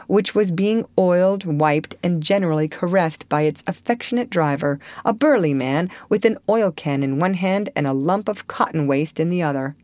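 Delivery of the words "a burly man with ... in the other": plain reading voice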